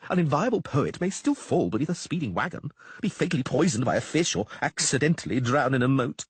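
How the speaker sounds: high, fast-talking frightened troubadour; nervous poet Dandelion. A narrator's reading. Frightened bard Dandelion warning Geralt about Chapelle, high and anxious. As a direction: rattle it off high and scared like a jittery bard.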